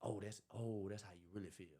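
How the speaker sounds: said low and soft, taking it in; quiet realization. Acted. Reacting to someone who cussed him out, quiet realization.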